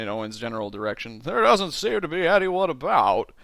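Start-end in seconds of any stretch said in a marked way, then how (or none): 1.2-3.3 raised drawly Jimmy Stewart; higher, louder Jimmy Stewart take. Acted.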